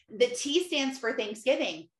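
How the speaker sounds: normal talking voice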